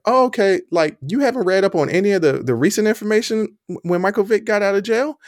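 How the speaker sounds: quoting his reply to a friend, incredulous. Retold or imitated. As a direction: act stunned that they haven't heard. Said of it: "And I was like".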